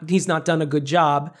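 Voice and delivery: usual speaking voice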